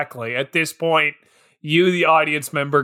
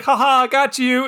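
mock gloating taunt; 6 semitones higher, 4 dB louder than usual. Mocking Moose's prank taunt.

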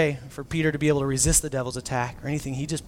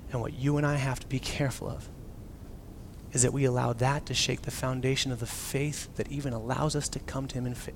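ordinary delivery

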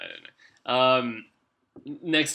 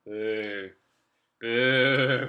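drawn-out heckling boo, loud; 6 dB louder than usual. Pretend. Friend heckling a bad joke, mock booing. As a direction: give a long mock boo.

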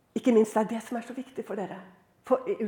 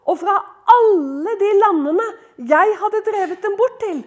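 loud, raised, slow proclamation; declaimed scripture reading; 6 semitones higher, 8 dB louder, slower than usual. Acted. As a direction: proclaim the verse to the congregation, stretching the key words. Reads verse at full force.

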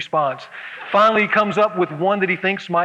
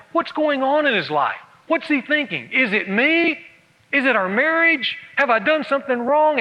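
higher, anxious questioning; anxious wife; 6 semitones higher than usual. Retold or imitated. Anxious wife fretting over her silent husband. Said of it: "I'm over there anxiously sitting there going".

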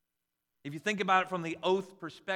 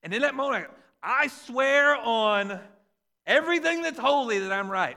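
emphatic preaching; 6 semitones higher, 9 dB louder than usual. Real, not acted. Preaching point, loud and slow.

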